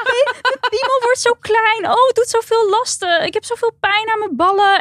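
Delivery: high-pitched whiny mock complaint; zeurende mannen. Mimics whining men complaining. (hoog, zeurderig klaagstemmetje)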